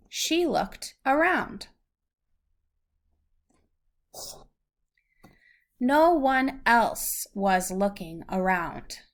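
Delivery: clear, measured storybook reading; reading to a child. Acted. Parent reads a picture book to a child, gentle storybook reading. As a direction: read it out slowly for a little listener.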